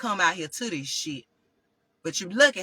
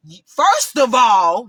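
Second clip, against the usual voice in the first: loud, slow drawn-out shout; yelling; 9 dB louder, much slower than usual. Retold or imitated. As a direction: blow up at the clerk, stretching every word. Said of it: "now I'm yelling".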